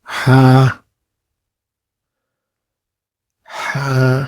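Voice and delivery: a light breathy ہا from the throat; throaty Arabic ha. Shown as a demonstration. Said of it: "گلے کے اندر سے ہلکی سانس کے ساتھ".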